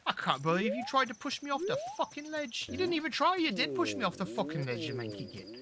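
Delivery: mock British accent, high and indignant. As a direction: play an outraged Brit, accent all through. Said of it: "I turned British".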